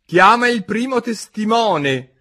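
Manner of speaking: a king pushing his jury; stern king. Acted. King urging the jury, booming.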